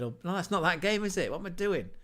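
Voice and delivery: usual speaking voice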